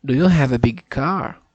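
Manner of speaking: loud, deliberate speech for the app; practicing a sentence for the pronunciation app, loud and deliberate; loud careful repeat. Shown as a demonstration. Saying it loud for the machine to catch. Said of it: "É, não deu".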